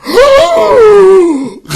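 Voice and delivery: shocked gasp, high and loud; huge gasp. Retold or imitated. Friend watching the big death, huge shocked gasp. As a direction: gasp like the twist just hit. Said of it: "saw that point and goes".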